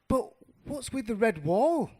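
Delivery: high, loud baffled question; puzzled. Acted. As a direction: ask urgently, full of puzzlement. Mouse puzzled, asks the bluebird.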